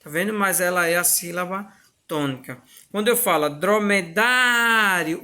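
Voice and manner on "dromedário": dromedário with a long, stretched "dá"; stressed penultimate syllable. Shown as a demonstration. Teacher showing the tonic syllable, dromedário with drawn-out "dá". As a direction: hold the "dá" in dromedário before dropping to "rio". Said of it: "o dário aqui".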